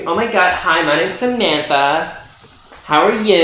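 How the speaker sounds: put-on Valley Girl voice. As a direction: gush like a mall girl meeting someone. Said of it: "Valley Girl voice".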